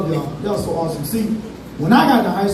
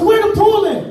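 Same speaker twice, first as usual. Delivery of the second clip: put-on high kid voice; 10 semitones higher, 4 dB louder than usual. Kid asking grandma.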